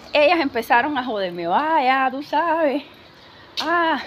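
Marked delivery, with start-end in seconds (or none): 1.5-4.0 higher, louder knowing jeer; mock teasing. Friends start ribbing her about the man, playfully teasing. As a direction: imitate friends ribbing someone about a crush.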